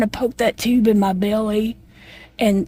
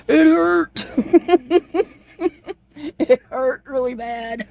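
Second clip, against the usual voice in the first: laughing through pain; 7 semitones higher, slower than usual. Real, not acted. Laughing while recalling pain.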